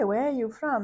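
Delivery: ordinary talk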